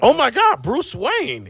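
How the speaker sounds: very high, starstruck mock gasp of words; hammed-up amazement; imitated shock. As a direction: exclaim like a stunned onlooker, pitch soaring. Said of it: "they're, like, shocked".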